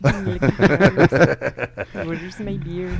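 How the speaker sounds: very slow mock Irish brogue; drunken leprechaun, hammed up; Irish accent. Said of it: "I'm too Irish".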